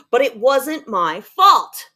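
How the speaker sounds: slow, loud denial landing hard on "fault"; emphatic protest. Acted. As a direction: protest it with exclamation marks. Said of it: "Three exclamation points".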